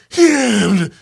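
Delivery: high, loud mock moan; hammed-up wail of pleasure; imitated orgasm noise. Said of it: "the sounds of her going".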